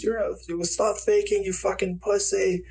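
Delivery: high-pitched mock heckler; imitating a heckler, pitched well up; crowd heckler. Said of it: "some guy in the crowd goes".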